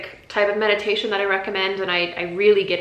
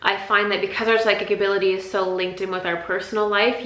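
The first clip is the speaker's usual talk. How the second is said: ordinary talk, nothing marked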